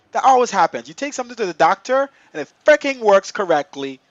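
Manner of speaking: loud, high exasperated complaint; exasperated outburst. Real, not acted. Streamer whose glitch won't show up, loudly exasperated. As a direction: complain loudly that it works only now. Glitch will not reproduce for demo.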